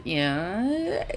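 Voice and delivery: lightly voiced stoner; soft, mildly stoned drawl; baked. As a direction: say it softly, a bit baked. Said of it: "he's definitely baked a bit".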